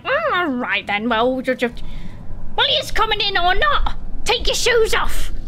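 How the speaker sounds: bossy demand at the door; stern wife. Acted. Wife ordering the guests to take their shoes off. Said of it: "she's like".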